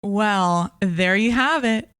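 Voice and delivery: usual speaking voice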